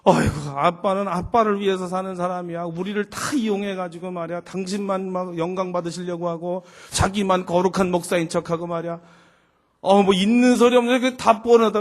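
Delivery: 높은 소리로 줄줄이 쏘아붙이기; 아빠를 비꼬며 쌓인 불만을 터뜨리는 시늉; 불만 쏟아내는 딸. Retold or imitated. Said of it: "컴플레인을 다 쏟는 거예요". (푸념 섞인 비난조)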